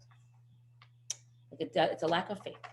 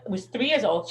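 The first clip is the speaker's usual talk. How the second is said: normal talking voice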